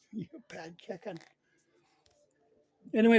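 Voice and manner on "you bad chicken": hushed whisper at the chicken; breathy hushed tease aimed at the pet; whispered scolding. Real, not acted.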